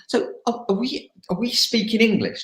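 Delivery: high-pitched mock bewilderment; high, halting imitation of a baffled teammate; imitating puzzled teammate. Retelling teammate's puzzled question.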